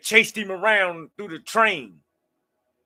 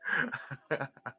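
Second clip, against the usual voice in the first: a soft, quiet chuckle to himself; real laugh; 11 dB quieter than usual. Real, not acted. Amused by his mom impression, a soft real chuckle. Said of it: "I'm laughing".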